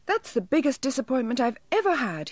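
high little elf, let down; mildly crestfallen elf voice; sighing disappointment. A narrator's reading. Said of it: "he said with a sigh".

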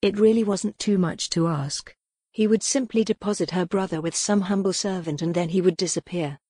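plain reading voice

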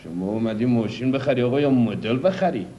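put-on a car dealer in his folksy regional Persian accent. Car dealer boasting to a buyer in a regional Persian accent. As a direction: lay on the regional Persian accent, showy and sly.

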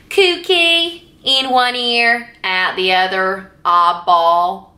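slow, shaky-handed try at Deep South; Deep South accent. Shown as a demonstration. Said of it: "I can't do it".

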